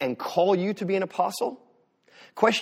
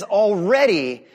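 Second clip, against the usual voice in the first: louder, drawn-out mock congratulation; sarcastic; 3 semitones higher, 5 dB louder, much slower than usual. Preacher voicing Paul's words to the Corinthians, sarcastic. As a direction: read it slowly with heavy sarcasm. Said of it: "Paul is being sarcastic here".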